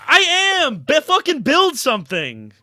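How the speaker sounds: frustrated outburst. Real, not acted. Fan fed up with short team deals, frustrated outburst. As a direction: blurt it out fed up.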